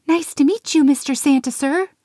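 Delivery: high, nervously polite elf; young elf voice. A narrator's reading. Pixie meeting Santa, high nervous elf voice. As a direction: squeak it out politely.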